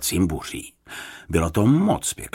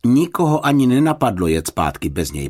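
emphatic assurance, higher and louder; reassuring; 5 semitones higher, 5 dB louder than usual. A narrator's reading. Husband assuring a friend nobody will leave, reassuring. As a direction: assure her firmly. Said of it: "ujistil ji pan Fousek".

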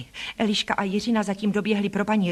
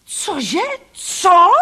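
high yell jumping louder; outraged roar; 10 semitones higher, 7 dB louder than usual. A narrator's reading. Boy stunned by his cousin's insults, roaring. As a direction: explode in disbelief. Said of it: "zařval".

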